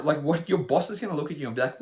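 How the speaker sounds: ordinary delivery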